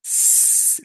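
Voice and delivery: "s-" as a sustained voiceless hiss; long isolated sibilant for "s-"; soft C sound. Shown as a demonstration. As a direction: hold the "s-" with no vowel. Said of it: "la C suena así".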